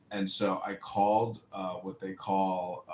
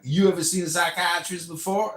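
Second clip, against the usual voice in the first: higher, louder put-on receptionist voice; mock receptionist; 7 semitones higher, 6 dB louder than usual. Caller mimics the hospital receptionist, higher and louder. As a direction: snap the question like an impatient receptionist.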